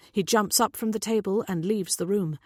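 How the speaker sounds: plain reading voice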